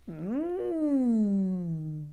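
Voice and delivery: ordinary delivery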